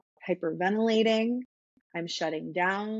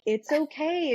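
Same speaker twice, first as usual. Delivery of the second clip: higher, a sing-song mock comfort; mock soothing; 8 semitones higher than usual. Somebody trying to calm her down, mock soothing. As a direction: imitate someone hushing you with a lilting okay. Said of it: "calm you down in that moment".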